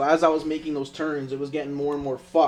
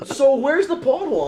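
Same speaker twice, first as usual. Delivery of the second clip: high, amused imitation of the dad; mocking dad's question; 9 semitones higher than usual. Retelling the buyer's dad, high amused mimicry.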